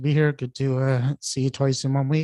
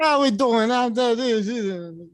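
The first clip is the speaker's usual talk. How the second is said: high-pitched, hammed-up Jamaican accent; mock Jamaican accent; 9 semitones higher than usual. Co-host greets the others in jest, mock Jamaican accent. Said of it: "I thought we were in Jamaica".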